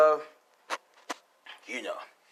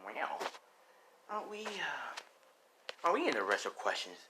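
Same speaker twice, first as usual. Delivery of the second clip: sly cat woman; 3 semitones higher, 5 dB quieter than usual. Acted. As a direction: toy with him like a cat. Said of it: "she's like".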